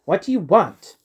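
high, loud and curt; terse. A narrator's reading. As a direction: snap it back impatiently. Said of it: "was the terse response".

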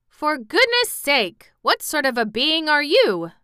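high, loud astonished exclamation; exclaiming. A narrator's reading. Cab horse meets a strange being, exclaiming. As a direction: cry out in amazement at the stranger. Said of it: "Then Jim exclaimed".

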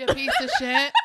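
loud laughing insult; laughing yell. Retold or imitated. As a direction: shout it through laughter.

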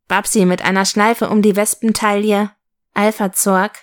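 ordinary delivery

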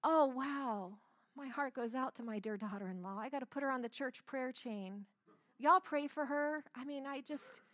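syrupy churchgoer's concern, put on; sanctimonious prayer-chain pity; mock pious sympathy. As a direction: gush with hammed-up compassion for a lost soul.

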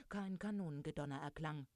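ordinary delivery

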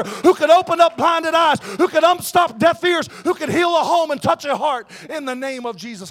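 building to a high, loud sermon peak; rally the congregation with rising urgency; impassioned testimony swelling into a shouted call; full-force preaching. Real, not acted. Preaches, voicing woman at well.

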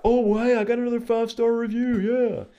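high, fast, hammed-up delight; mock excitement. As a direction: pretend to gloat over a review.

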